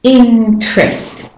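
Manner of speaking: "Interest" slowly as "innn-trest"; held N. Shown as a demonstration. Teacher showing how to hold the N, drawn out. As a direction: stretch the n in "Interest" straight into "trest". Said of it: "You hold on to the N a bit longer".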